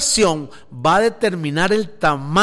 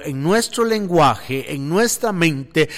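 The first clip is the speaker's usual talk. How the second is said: ordinary talk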